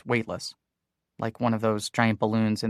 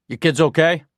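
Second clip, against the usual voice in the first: raised, higher calling voice; 6 semitones higher, 9 dB louder than usual. A narrator's reading. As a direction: call across the dark store. Manager calling in dark store.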